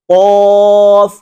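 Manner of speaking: قاف drawn out very slowly from the back of the throat; Arabic qaf stretched out as a model; deep qaf. Shown as a demonstration. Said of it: "نقطوں والا قاف میں زبان کا بالکل آخری حصہ".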